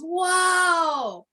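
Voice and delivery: high, drawn-out joyful whoa; excited old man's whoa. Retold or imitated. Old singer greeting the applauding crowd, joyful whoa. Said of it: "he goes".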